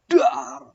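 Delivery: sudden loud, high dar for the blow; strike sound. Acted. Preacher voicing Moses' staff hitting the rock, loud bang.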